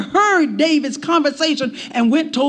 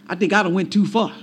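Job backing down; 8 semitones lower, faster than usual. Retold or imitated. Job realizing he overstepped.

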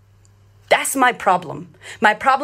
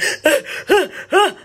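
high, gasping mock sobs; imitated ugly sob; 9 semitones higher than usual. Showing viewers how she cried last night, imitated ugly sobbing. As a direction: imitate gulping, hitching sobs. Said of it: "that ugly sob cry".

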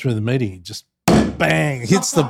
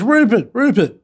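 calling out; 10 semitones higher, 7 dB louder than usual. Retold or imitated. Trump calling on speakerphone.

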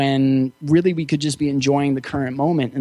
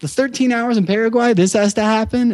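much higher, incredulous complaint thrown at the sky; comic mock-despair, pitched way up; exasperated plea to God; 7 semitones higher than usual. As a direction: ask God in disbelief, why now. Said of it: "I'm like".

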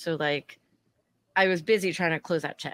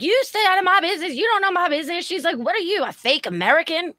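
much higher, shrill-pitched imitation; mocking drunk woman; 10 semitones higher, 4 dB louder than usual. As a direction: ape the drunk lady screeching.

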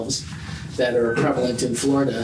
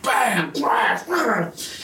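crash sounds; 7 semitones higher, much slower than usual. Retold or imitated. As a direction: blurt out bam and crash like a sudden noise. Imitating the crash in the closet.

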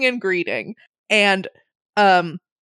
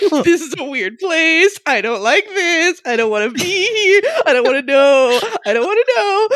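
mock horror; 8 semitones higher, 4 dB louder than usual. Horrified inner reaction.

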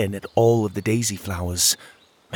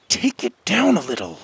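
scolding; 8 semitones higher than usual. A narrator's reading. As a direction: snap her name to rein her in. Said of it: "Winnie scolded".

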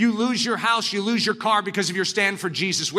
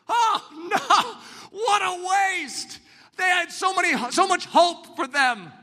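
high, half-laughing lament; mock the parents' dismay; disappointed parents; 10 semitones higher, slower than usual. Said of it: "What do some of your parents say?".

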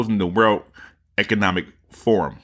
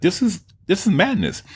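higher, appalled imitation; a hint of stunned podcaster; mock disbelief; 9 semitones higher than usual. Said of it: "he's like".

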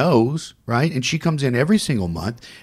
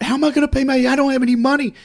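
very high, raised panicky protest; retold freak-out; 4 dB louder, much faster than usual. Woman with no money for the bill, freaking out. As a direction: act out her freaking out. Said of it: "she's freaking out".

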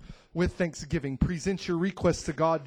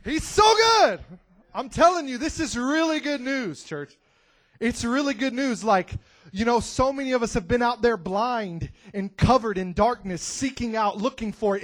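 loud, high, fervent shout; excited preacher calling out good news; preaching at full force; 6 semitones higher, 5 dB louder than usual. Real, not acted. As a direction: preach it out to the church. Preacher gets fired up.

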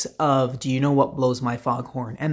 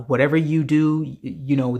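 normal talking voice

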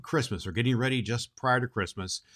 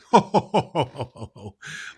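jolly laugh; 4 semitones higher, 4 dB louder than usual. Real, not acted. Amused by the letter.

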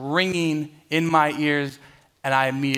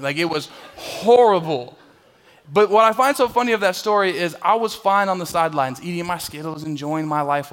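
ordinary talk, nothing marked

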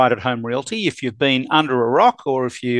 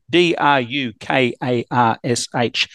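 normal talking voice

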